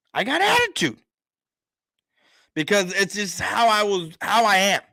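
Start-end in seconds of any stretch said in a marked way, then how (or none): 0.1-1.0 I got attitude jumps up high, sassy; high sassy repeat. Shown as a demonstration. Host owning his attitude to viewers, high and sassy. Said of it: "repeats the line higher".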